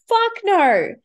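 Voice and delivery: loud, high fuck no; laughing emphatic refusal. Real, not acted. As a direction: belt out the denial. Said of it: "repeated emphatic denial".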